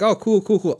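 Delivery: high, light skimming approval; breezy high-pitched reenacted approval; mock pleased reaction. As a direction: toss it off high, as if skimming praise. Voicing himself reading nice comments.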